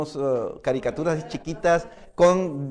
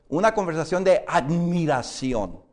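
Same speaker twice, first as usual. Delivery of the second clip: normal talking voice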